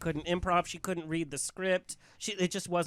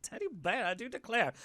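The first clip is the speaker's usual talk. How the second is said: Southern accent; 5 semitones higher, 4 dB quieter than usual. Shown as a demonstration. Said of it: "with a southern accent".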